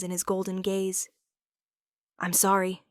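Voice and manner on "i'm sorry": ordinary delivery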